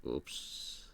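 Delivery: a breathy little whispered oops under the breath. Real, not acted. Streamer fumbling the edit tool, to himself, whispered oops. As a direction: let the oops slip out as a whisper. Slip while editing photo.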